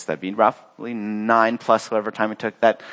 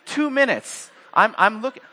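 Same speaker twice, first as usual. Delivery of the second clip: usual speaking voice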